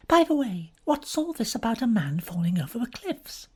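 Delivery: lightly voiced young lady, changing the subject; Frankie's voice. To Bobby, a young woman asking about the cliff accident.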